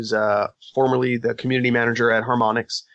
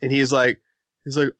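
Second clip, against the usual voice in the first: normal talking voice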